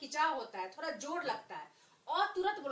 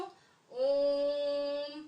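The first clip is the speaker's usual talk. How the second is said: stretching the words slowly for the class; slow, drawn out; 6 dB louder than usual. Shown as a demonstration. Teacher to children, slow and stretched. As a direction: drag it out like the long ओ. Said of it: "बहुत देर तक खींचना है".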